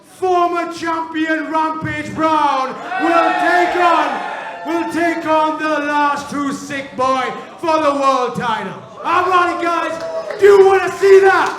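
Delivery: ring announcer. Acted. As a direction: announce the main event with hype. GM announcing main event.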